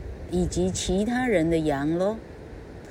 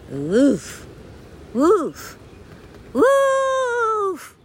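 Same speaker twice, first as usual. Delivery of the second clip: loud woof calls climbing higher each time; shouting woof; 4 dB louder, much slower than usual. Acted. Jack calling through his hands to fool the villagers, rising loud shouts. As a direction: bark it out across the hillside, higher and higher. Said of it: "and shouted".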